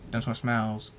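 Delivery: ordinary delivery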